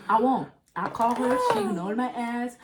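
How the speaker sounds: normal talking voice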